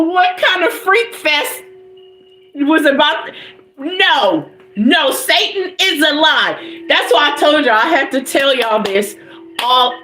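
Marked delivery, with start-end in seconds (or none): none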